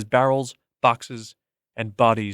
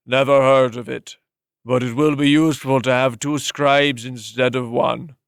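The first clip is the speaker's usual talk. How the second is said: put-on curt old-man voice; dismissive master. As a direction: brush it off, then decide.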